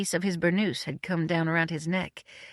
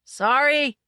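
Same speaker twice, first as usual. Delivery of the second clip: cried out; 8 semitones higher, 5 dB louder than usual. A narrator's reading. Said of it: "cried a faint voice".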